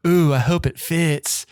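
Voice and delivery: slow seductive purr; sultry voice. Pretend. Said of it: "read the text in a sultry voice".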